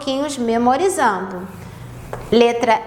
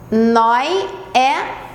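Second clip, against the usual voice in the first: ordinary talk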